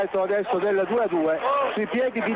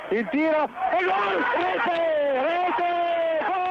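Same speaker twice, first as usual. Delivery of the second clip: excited goal call; goal shout; 7 semitones higher, 4 dB louder, much slower than usual. Real, not acted. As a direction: roar the goal.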